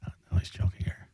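hushed whispered aside; whispering. Real, not acted. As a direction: whisper it under your breath. Aside while watching the show.

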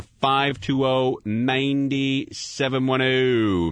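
very slow, spelling out each digit; slow number read. Shown as a demonstration. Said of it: "Anything you like talking about on the air".

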